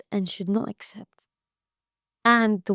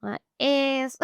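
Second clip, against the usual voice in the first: put-on American accent; 5 semitones higher than usual. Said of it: "American version".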